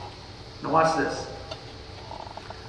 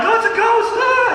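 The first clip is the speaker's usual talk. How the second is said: high, loud mock scream of fright; a panicked yelp, much higher and louder; 5 dB louder than usual. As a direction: ham up the terrified disciples. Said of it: "they all got freaked out".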